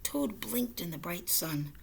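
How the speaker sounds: plain reading voice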